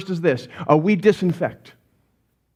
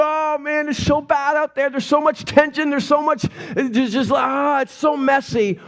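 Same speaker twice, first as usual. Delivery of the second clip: higher-pitched mock panic; freaking out; 5 semitones higher than usual. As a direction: whine in exaggerated overwhelmed panic. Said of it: "We can freak out".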